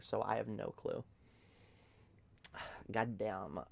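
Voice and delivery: usual speaking voice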